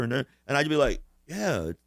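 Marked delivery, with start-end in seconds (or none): 1.3-1.8 slow, soft, higher-pitched mock answer; clueless bluffing. High pupil to teacher asking where China is, mock vague bluffing. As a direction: drag it out like a pupil faking it.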